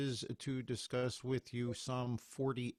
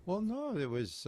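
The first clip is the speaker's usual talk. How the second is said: ordinary delivery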